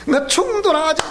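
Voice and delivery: ordinary delivery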